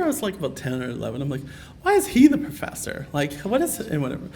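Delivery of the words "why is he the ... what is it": high, loud mock indignation; baffled child. Ten-year-old questioning brother's nickname, baffled and indignant. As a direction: protest like a puzzled kid who feels slighted. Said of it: "this doesn't make sense to me".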